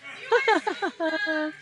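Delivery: high burst of laughter-yoga laughing; laughing on cue. Shown as a demonstration. Instructor laughing for the class while patting her face. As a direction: let a bright forced laugh pop out. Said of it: "give your face some little pats and laugh".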